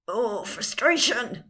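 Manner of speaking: slow, drawn-out exasperated outburst; mock frustration. Shown as a demonstration. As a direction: demonstrate frustration, hammed-up and slow. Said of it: "That is my frustrated face".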